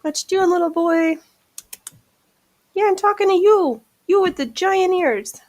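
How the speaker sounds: high teasing voice to a cat; pet talk. Real, not acted. Teasing the big-eared cat, high playful pet voice. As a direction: coo up high at the little cat.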